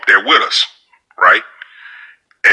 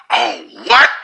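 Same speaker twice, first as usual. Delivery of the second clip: shocked. Retold or imitated. Union guy hearing the threat, startled gasp. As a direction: react like he can't believe it.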